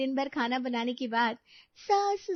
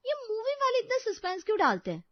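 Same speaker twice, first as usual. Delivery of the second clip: exasperated complaint; 10 semitones higher than usual. Acted. Frustrated at movie suspense.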